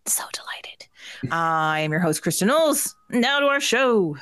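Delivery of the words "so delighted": whisper. Acted. Whispered after squealed delight.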